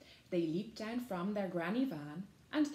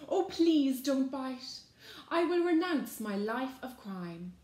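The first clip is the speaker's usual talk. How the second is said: begging; 6 semitones higher, 7 dB louder than usual. A narrator's reading. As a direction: beg theatrically for mercy. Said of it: "Another begged".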